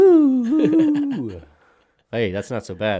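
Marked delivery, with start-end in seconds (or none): 0.1-1.4 long high sung ooh; drawn-out falsetto ooh; high crooning ooh. Real, not acted. Reacting to light skin joke.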